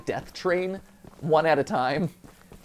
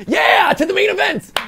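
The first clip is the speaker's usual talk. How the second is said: excited shout. Real, not acted. Player hypes up the final fight, excited shout. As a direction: shout it like an arena hype man. Hyping the start of boss fight.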